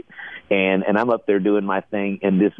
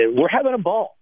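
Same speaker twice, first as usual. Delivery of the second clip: excited; 11 semitones higher than usual. Real, not acted. Excited about tequila success.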